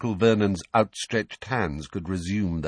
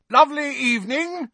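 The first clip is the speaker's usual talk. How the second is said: high, loud sing-out greeting; falsely cheery, high-pitched shout; 5 dB louder than usual. A narrator's reading. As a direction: call out to a neighbour across the street. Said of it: "shouted Uncle Vernon".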